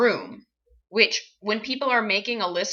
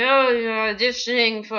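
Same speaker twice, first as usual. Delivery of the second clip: imitating a director's vaguely French accent; weird accent. Director addressing an auditioning actor, weird foreign accent. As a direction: attempt an odd, sort-of-French accent. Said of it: "this is the best I can do".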